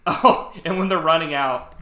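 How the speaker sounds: ordinary talk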